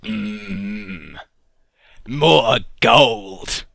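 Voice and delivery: a lightly voiced Willem Dafoe. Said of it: "And Willem Dafoe says".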